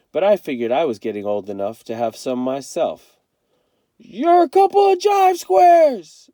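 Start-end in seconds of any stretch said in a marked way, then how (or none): 4.0-6.3 high, loud, slow jeering yell; mocking shout. A narrator's reading. Kenny jeers at his departing siblings, shouting. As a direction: hurl the insult after them. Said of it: "I shouted".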